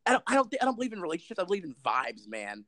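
mock stoner, starting high and falling away. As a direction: parody a weed smoker, trailing down at the end. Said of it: "people who smoke weed".

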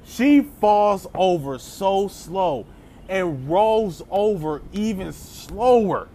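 slow and drawn out; slow mock-dramatic narration; slow-motion telling. Shown as a demonstration. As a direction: drag every word like slow motion. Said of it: "slow motion".

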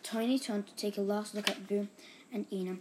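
usual speaking voice

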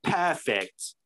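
one-word mock British approval; British accent. The dad accepting the tiger, mock British accent. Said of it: "doing a British accent".

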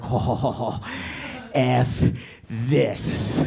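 low, halting mock fury; spaced-out words, seething in pretend; retold anger. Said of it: "I'm pissed".

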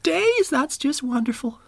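high, sugary grandma voice; high-pitched chirpy old woman; sweet old lady voice. Retold or imitated. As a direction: play the kindly fabric store lady. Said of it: "Bethany goes".

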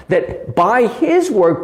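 ordinary delivery

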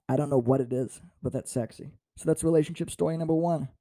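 usual speaking voice

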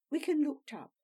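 ordinary delivery

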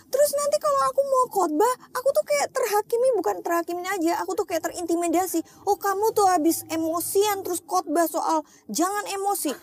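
high, whining mock lament; crying complaint. Speaker retells feeling judged for preaching against anger, wailing. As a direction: imitate pouring it all out in a wail. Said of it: "ngomong sama Tuhan tuh kayak gitu".

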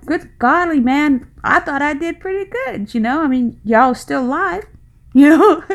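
a mother protesting she did fine, chuckling; exasperated disbelief. Retold or imitated. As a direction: parent huffing that her grown kids turned out fine. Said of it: "you're sitting there thinking".

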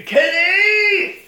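high, drawn-out wail; hammed-up anguished call; mock desperate call. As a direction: cry your partner's name as he's dragged off. Partner calls back.

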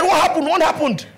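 loud, high, disbelieving; stunned father. Retold or imitated. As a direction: blurt it out in shock. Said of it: "And he said".

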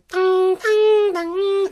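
high ringing ding sounds; imitated gong.

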